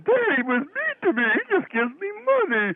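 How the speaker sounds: high, whiny mock sobbing child; whiny crybaby voice. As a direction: whimper like a bawling kid. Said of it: "you're crying".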